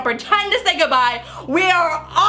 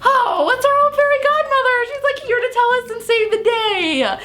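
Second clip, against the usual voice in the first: giddy; 9 semitones higher than usual. Retold or imitated. Joking about the fairy godmother.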